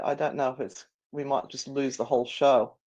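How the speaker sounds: ordinary delivery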